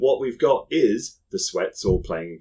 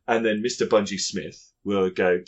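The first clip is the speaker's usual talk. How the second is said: normal talking voice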